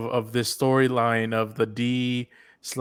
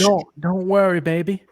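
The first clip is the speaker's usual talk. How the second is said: mock Alpha's put-on accent; 8 semitones higher, 5 dB louder than usual. Co-host mimics Alpha soothing Lydia in put-on Southern accent. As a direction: coo it in her fake Southern accent. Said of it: "that accent she's putting on".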